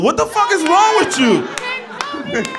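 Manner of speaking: high, slow, stretched-out disbelief; incredulous. Real, not acted. Host mocking a guy's huge blunt, slow incredulous cry. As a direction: drag out the outrage.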